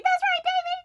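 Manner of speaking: very high squealed cheer; acted ecstatic cheer. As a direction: cheer someone on mid-coaster, hammed up. Wife cheering during the ride.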